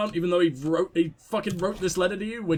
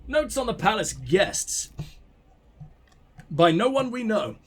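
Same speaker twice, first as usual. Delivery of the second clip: ordinary talk, nothing marked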